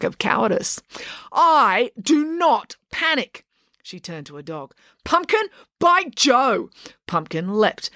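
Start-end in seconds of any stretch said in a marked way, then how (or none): 1.3-3.4 slow, higher, emphatic words; indignant denial. A narrator's reading. April answers the charge of panic, indignant denial. 5.0-6.7 high, slow dog command; commanding call. A narrator's reading. April sets her dog on Joe, commanding call.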